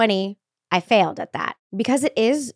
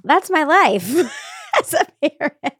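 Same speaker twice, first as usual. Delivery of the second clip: breaking into a laugh mid-phrase; 8 semitones higher, 4 dB louder than usual. Real, not acted. Mom laughing about her kids' naked antics, giggling. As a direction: laugh through the punchline, higher.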